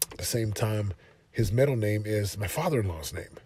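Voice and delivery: normal talking voice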